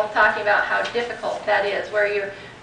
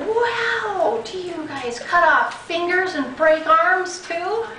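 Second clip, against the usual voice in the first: sarcastic; 8 semitones higher, slower than usual. Retold or imitated. Sarcastic retort to official on phone.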